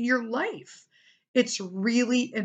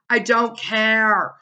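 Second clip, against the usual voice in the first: slow; 2 semitones higher, 8 dB louder, much slower than usual. Real, not acted.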